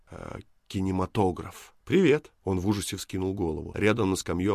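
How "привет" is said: high, loud hello; sudden greeting. Acted. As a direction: pipe up brightly to startle a stranger. Stranger's sudden greeting startles him.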